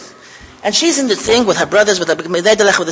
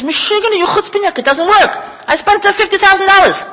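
indignant complaint; 10 semitones higher than usual. Retold or imitated. Angry car owner yells at manufacturer.

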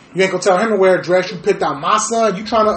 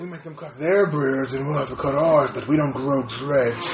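viking voice; 4 semitones lower, 3 dB quieter than usual. Retold or imitated. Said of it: "Why you make it sound like a fucking Viking".

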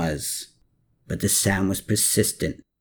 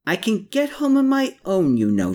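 grumbling; 9 semitones higher than usual. A narrator's reading. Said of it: "she grumbled".

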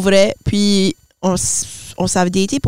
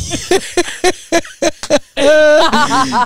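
high burst of laughter; helpless giggling fit; laughing; 6 semitones higher than usual. Real, not acted. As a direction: crack up at your own line. Cracks up at her own line.

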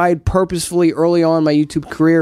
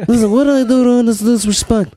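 higher, hammed-up Godfather impression; 5 semitones higher than usual. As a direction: play a mock-offended Godfather through a laugh. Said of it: "answer this in the Godfather voice".

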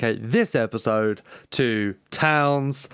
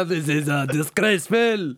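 mock French accent; 3 semitones higher than usual. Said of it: "You sound like he's from Dijon".

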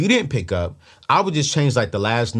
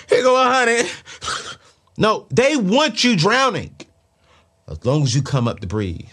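preaching at full force; 9 semitones higher, 4 dB louder than usual. Acted. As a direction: preach it like a fired-up pastor. Preaching about lenders and debt.

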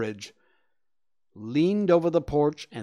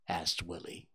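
usual speaking voice

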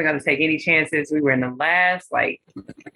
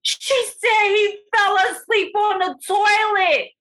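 imitating the girl; slower than usual. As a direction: squeal the gossip out, high and slow.

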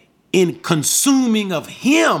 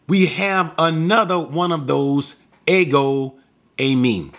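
usual speaking voice

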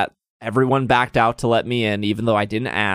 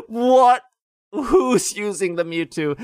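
incredulous mock; 8 semitones higher, slower than usual. Real, not acted. Mocking the guy in disbelief.

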